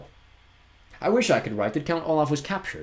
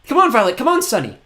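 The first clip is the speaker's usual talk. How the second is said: much higher, loud urging; urgent call; 13 semitones higher, 10 dB louder than usual. Acted. Klaus urges Violet and Sunny, loudly. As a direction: hurry them along.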